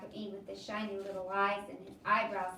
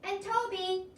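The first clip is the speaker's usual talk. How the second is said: high called addition from behind; a little boy joining the call; 11 semitones higher, 5 dB louder, slower than usual. Acted. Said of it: "Toby called from behind".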